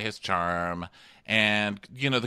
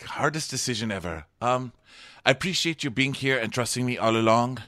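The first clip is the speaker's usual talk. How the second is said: mock Bachelor accent; 4 semitones higher than usual. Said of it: "he's like".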